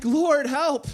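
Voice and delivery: high, loud cry for help; urgent prayer. Acted. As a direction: plead as if sinking. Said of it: "his prayer is like".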